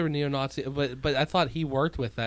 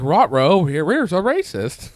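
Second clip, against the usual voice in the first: a louder, goofy cartoon dog voice; talking dog voice; 5 semitones higher, 10 dB louder, slower than usual. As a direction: bark the words like a silly cartoon dog. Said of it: "Was she like".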